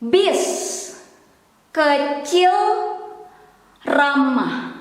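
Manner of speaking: one word at a time, long pauses; spoken slowly and evenly; slow pronunciation demo. Shown as a demonstration. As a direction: model each word carefully for learners. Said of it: "now we will try to pronounce slowly".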